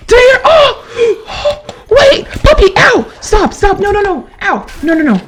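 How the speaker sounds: high, loud panicked shrieks; panicked screaming. Real, not acted. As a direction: scream for your life. Attacked by dog in game.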